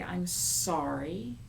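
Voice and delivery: said slowly; slow sincere apology. Shown as a demonstration. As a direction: model it slowly. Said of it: "that's how you say".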